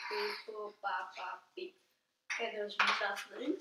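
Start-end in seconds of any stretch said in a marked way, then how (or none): 0.1-1.7 soft, low beeping noises; scanner beeps. Pretend. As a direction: make the scanner go beep. Pretend security scanner noises.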